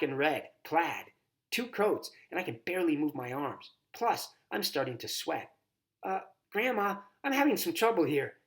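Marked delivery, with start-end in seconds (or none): 6.0-8.4 put-on girl in trouble. A narrator's reading. Girl stuck in coats.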